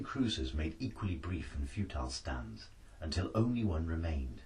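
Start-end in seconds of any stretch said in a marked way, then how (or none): none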